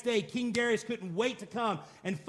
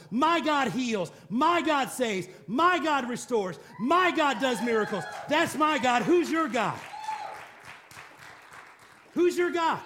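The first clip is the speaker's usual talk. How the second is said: building shout, louder line by line; shouted praise; 3 semitones higher, 4 dB louder than usual. Real, not acted. Preacher shouting praise to the congregation, rising loud.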